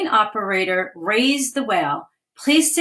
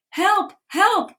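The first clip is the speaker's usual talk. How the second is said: mock yell for help; 9 semitones higher than usual. Girls calling for help as the thief runs, a mild mock yell. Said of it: "Nicole and Haley yelled for her".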